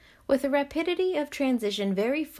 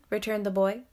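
usual speaking voice

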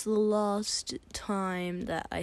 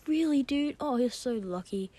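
mock amazed, higher-pitched reaction; impressed friend; 6 semitones higher than usual. Friend reacting to girlfriend news, mock impressed. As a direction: act out a friend's envy, pitched up.

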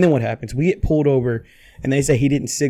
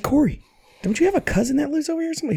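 high, softer quoted question; retold high-pitched question; 13 semitones higher, 4 dB quieter than usual. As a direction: ask it high and under your breath. Retold urgent hushed question to Corey.

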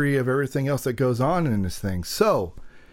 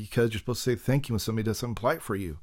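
normal talking voice

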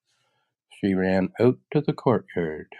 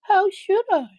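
put-on very high, louder girl voice; little girl; 6 dB louder than usual. Dorothy says she could not have known, girlish protest. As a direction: protest in a squeaky child's voice.

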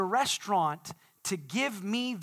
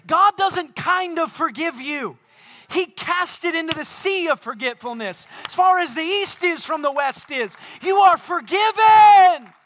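full-force preaching; 10 semitones higher, 12 dB louder than usual. Real, not acted. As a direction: proclaim it to the whole church, building to a roar.

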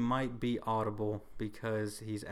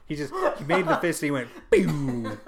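laughing; 5 semitones higher, 10 dB louder than usual. Retold or imitated. Laughing retelling of Thanos.

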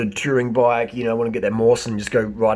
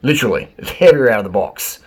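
ordinary talk

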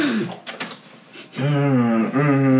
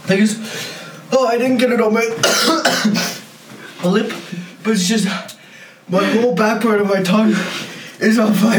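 strained higher voice, coughing; in real pain from the heat; mouth burning from spicy food; 7 semitones higher than usual. Real, not acted. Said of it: "it's burning everywhere".

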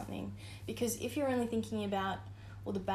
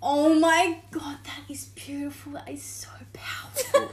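gushing friend impression; 5 semitones higher, 10 dB louder than usual. Mocking her cohost's awed praise, whispered. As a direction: whisper it in reverent awe. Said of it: "Maddie's like".

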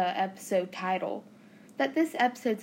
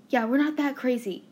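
ordinary talk, nothing marked

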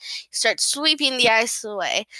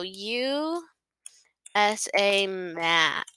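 ordinary talk

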